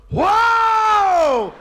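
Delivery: huge high drawn-out "whoa"; wife's labor yell. Retold or imitated. Husband retells his wife's first labor pain, huge high yell. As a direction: howl it out at full volume. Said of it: "My wife goes".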